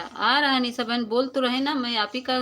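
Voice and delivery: insistent. Real, not acted. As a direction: assure her firmly that her comment is coming through.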